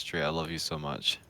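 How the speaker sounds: usual speaking voice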